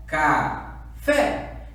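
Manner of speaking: CA, pause, then stressed FÉ; calling the word. Shown as a demonstration. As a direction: call out "Café" in two beats, leaning on FÉ. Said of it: "Mesma coisa do café".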